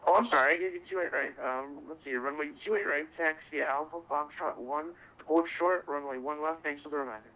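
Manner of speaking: ordinary talk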